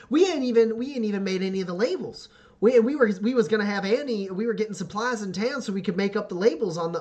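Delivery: high, loud, eager rush of words; excited young man. Acted. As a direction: gush like a thrilled son showing off. GM voices excited son Daryl.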